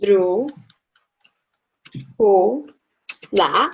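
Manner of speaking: dru, hu, la each said apart, with pauses between them; split into syllables. Shown as a demonstration. Teacher spelling out a word syllable by syllable for a student. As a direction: break drújula up, one syllable at a time. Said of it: "Now we have es".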